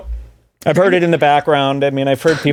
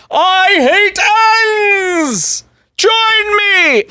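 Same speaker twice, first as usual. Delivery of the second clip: much higher, louder mock rally cry; mock rallying shout; 3 dB louder, much slower than usual. Mocking a pundit's Twitter post as a rally shout. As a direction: yell it like a cartoon crusader. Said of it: "He just goes on Twitter".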